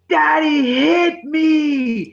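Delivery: loud, high, drawn-out mock wail; crying child. Daughter pointing at dad before mom, imitated crying wail. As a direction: bawl it slowly like a tattling toddler. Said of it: "immediately starts crying full volume".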